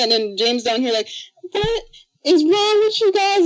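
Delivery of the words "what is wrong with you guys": very high, slow scolding; hammed-up high-pitched dismay; mock exasperation. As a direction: imitate an appalled friend, high and drawn out. Imitates friend scolding the chat.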